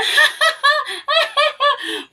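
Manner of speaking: high loud mocking cackle; mocking laugh. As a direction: laugh him to scorn, shrill and loud. Mourners laugh at Jesus.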